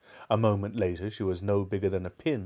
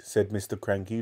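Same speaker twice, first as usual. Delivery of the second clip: ordinary delivery, nothing marked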